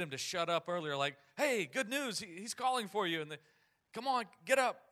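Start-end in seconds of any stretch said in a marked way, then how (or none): 1.4-3.2 high, loud, eager announcement; acted crowd member calling over; excited calling out. Crowd excitedly calling the blind man. 3.9-4.9 high, loud call to action; acted excited shout; eager urging. Crowd urging the blind man up.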